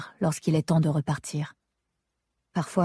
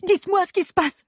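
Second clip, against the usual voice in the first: much higher, desperate demand; frantic pleading cry; 10 semitones higher than usual. Acted. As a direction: cry out for answers in panic. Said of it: "Je me mets à crier".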